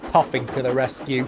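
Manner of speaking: ordinary delivery, nothing marked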